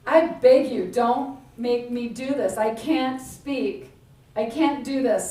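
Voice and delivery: a desperate plea to be let off; pleading Moses. Acted. Moses pleading to avoid task.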